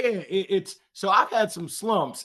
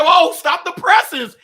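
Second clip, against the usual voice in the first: much higher, louder, slow hammed-up gasp of surprise; mock astonishment; 13 semitones higher, 9 dB louder, slower than usual. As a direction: stretch it out like big news. Mocking manager's reaction.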